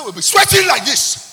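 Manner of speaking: high, loud hammed-up yell; retold friend in a hammed-up shout; mock loud sweating prayer. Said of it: "He said".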